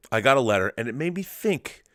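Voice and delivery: ordinary delivery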